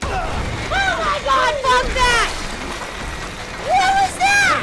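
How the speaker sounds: loud frightened yell; panicked yelling. Real, not acted. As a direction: shout in real terror. Scared by game monster.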